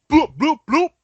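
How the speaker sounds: high, loud cartoon bloops; playful high bloop sounds; silly bloop noises. Silly noise after accent bit.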